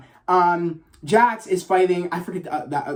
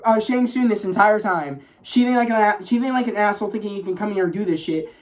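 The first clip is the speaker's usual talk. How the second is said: normal talking voice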